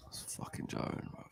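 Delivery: fed-up whisper under the breath; muttered whisper. Real, not acted. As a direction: breathe it out in disgust. Fan reacts to conceded goal.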